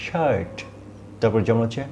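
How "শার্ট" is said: শার্ট with a long আ from the throat; long throat vowel. Shown as a demonstration. Teacher modelling the long vowel, pitched up. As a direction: stretch the আ in শার্ট. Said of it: "আমাদের সাউন্ডটা হচ্ছে".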